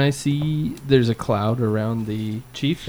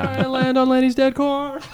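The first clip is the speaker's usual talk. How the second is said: laughing through the words; giggling, barely getting it out; 11 semitones higher than usual. Real, not acted. Giggling at a joke.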